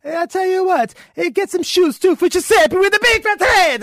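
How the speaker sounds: put-on loud, high-pitched hammy Italian accent; Italian guy. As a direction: bellow it as an excitable Italian customer. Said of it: "then the Italian guy says".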